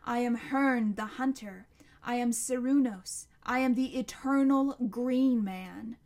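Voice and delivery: loud, ringing monster proclamation; roaring monster. A narrator's reading. Monster declares its names to Connor, roaring. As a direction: thunder out each title. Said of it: "roared the monster".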